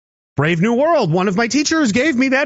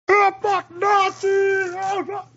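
much higher, hammed-up panicky squeal; mock panicked shriek; 11 semitones higher, 3 dB quieter, slower than usual. Mocking the people out at sea, a much higher hammed-up shriek. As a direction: squeal it like a hysterical caricature.

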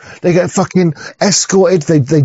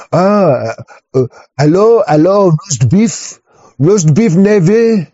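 halting mock French accent; French coast guard accent. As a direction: put on a hesitant French accent. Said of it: "Coast Guard would be like".

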